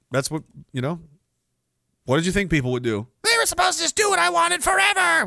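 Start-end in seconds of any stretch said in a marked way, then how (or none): 3.2-5.2 high, whiny mock shout; hammed-up tantrum voice, much higher; mocking whiny complaint. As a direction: whine it like a spoiled brat.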